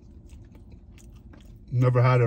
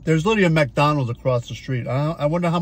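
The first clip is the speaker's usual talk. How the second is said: bits of food in mouth; speaking between bites; talking while chewing; 3 semitones higher than usual. Real, not acted. Seen 1: Chews after bite at -7.0, keeps chewing while starting to talk.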